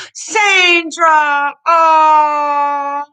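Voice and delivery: loud, high, breaking oh; real overwhelmed joy near tears; overwhelmed cry. Real, not acted. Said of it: "I'm a bit emotional right now".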